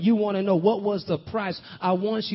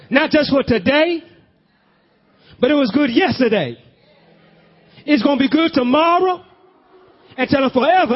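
louder and higher, building hard; full-force preaching; 5 semitones higher, 5 dB louder than usual. Acted. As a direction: drive it home to the congregation.

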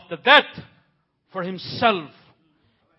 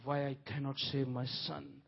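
God resigned; 6 semitones lower, 7 dB quieter than usual. Acted. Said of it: "Then God said".

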